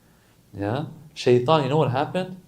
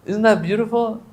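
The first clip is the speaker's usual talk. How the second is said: ordinary talk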